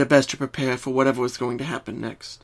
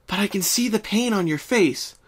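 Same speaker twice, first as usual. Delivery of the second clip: pleading friend, higher voice; higher, earnest pony friend begging to help; Twilight, gentle; 8 semitones higher than usual. Acted.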